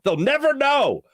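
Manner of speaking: put-on high, loud, slow lament of the old days; mock grumpy old-timer. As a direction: drag it out like a cranky old-timer. Repeats line for effect.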